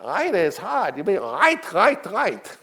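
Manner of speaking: put-on high, insistent old mogul voice; Sam Goldwyn impression. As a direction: nag him to keep writing, in a high fussy voice. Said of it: "he talked like I'm gonna talk".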